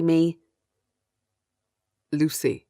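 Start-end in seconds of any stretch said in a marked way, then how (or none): 2.1-2.7 a lightly voiced low captor, calling her name; a hint of a gravelly kidnapper greeting his prisoner. A narrator's reading. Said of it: "His voice is low and gravelly".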